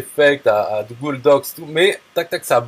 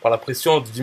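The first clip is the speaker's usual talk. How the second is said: ordinary talk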